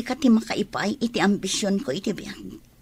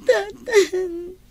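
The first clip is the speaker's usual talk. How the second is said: high tearful call; crying; 7 semitones higher than usual. Acted. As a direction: sob out the word. Daughter cries to scolding father. (agsangsangit nga umaw-awag)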